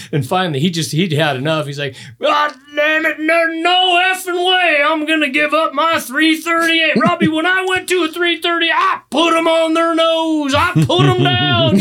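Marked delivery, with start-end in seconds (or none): 2.2-11.8 high, loud, drawn-out indignant rant; imitating a worked-up dad; fired-up dad. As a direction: rant like an outraged old father refusing to budge. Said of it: "he was getting fired up".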